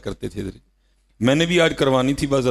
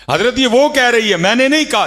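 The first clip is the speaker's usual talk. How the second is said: much higher and louder pleading cry; impassioned cry; 13 semitones higher, 8 dB louder than usual. Acted. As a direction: cry it out to the congregation. Same words again, outburst.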